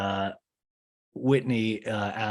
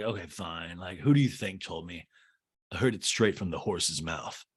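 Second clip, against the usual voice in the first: deadpan Austin; 5 semitones lower, 5 dB quieter than usual. Retold or imitated. Austin telling Craig he heard it himself, low deadpan. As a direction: lower and softer, flat comeback imitation.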